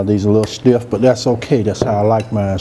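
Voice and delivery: ordinary delivery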